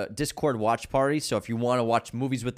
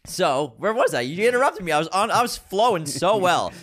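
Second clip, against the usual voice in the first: loud mock indignation; playfully offended, high energy; amped up; 5 semitones higher, 5 dB louder than usual. Real, not acted. Mock complaint at interruption.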